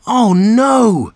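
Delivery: high, loud gasp of alarm. A narrator's reading. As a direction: gasp it out in fright. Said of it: "gasped Chip".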